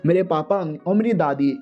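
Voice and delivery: usual speaking voice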